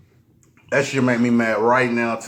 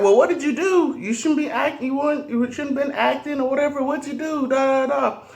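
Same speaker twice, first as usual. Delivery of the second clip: mother questioning; 13 semitones higher than usual. Retold or imitated. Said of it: "She's like".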